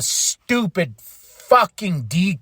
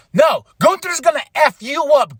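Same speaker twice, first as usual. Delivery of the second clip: normal talking voice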